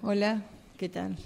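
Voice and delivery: low, soft hello; subdued greeting. Acted. Acted subdued greeting, contrasting the first. (saludo apagado, bajo y suave)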